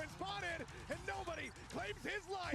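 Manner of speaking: high hype scream; esports caster scream. Real, not acted. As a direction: scream the clutch.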